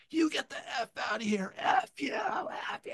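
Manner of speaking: mock screaming woman, high-pitched; retold scream. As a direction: imitate a street woman yelling you off. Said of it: "she starts screaming at me".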